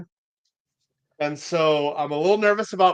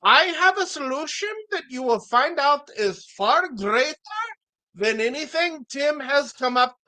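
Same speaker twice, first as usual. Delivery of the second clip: put-on comic foreign accent, pitched well above usual; [name]'s foreign accent; 8 semitones higher than usual. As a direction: plead the town's case in a hammed-up foreign accent. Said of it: "I have to speak in a foreign accent".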